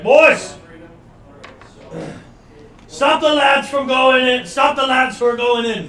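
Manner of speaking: high, loud yell to the men; urgent shouted order. Acted. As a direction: call out hard across a distance. Game master's character calls to his men.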